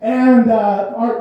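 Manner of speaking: ordinary talk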